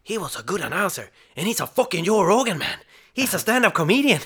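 loud, high Scottish accent, mimicking a fan; hammed-up Scottish accent bragging about him. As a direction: throw on a heavy Scottish brogue, excited and high. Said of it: "vilken bra accent du hade".

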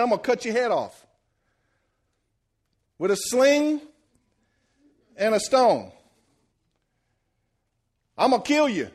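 high, loud boast in separated bursts; emphatic trash talk. Acted. David tells the giant his fate, boldly emphatic.

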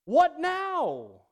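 slow, loud, high-pitched cry to the sky; a retold furious yell at God. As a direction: hurl it up at heaven in disbelief. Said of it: "I still remember yelling at God".

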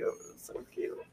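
the same words repeated in a soft whisper, no voice; whispered gush. Real, not acted. Gushing to viewers about her son, whispered repeat. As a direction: breathe it out like you're melting.

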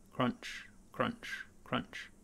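crunch fading to a whisper; crunching footsteps. Acted. Footsteps approaching, voiced.

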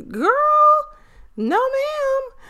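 high, drawn-out no ma'am; sassy dismissal. Retold or imitated. Rejecting the girl throwing Pop-Tarts, high sassy refusal. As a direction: shut her down with attitude.

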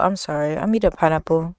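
ordinary delivery

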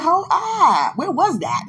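high, loud mock astonishment; imitating a taken-aback listener; startled listener. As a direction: halt them in exaggerated disbelief. Acting startled listeners hearing her rap.

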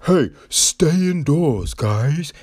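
slow, put-on chirpy advice voice; mocking a website telling guys to stay indoors. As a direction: parody a preachy dating site. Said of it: "it's just like".

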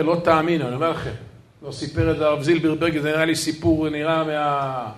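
usual speaking voice

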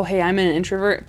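normal talking voice

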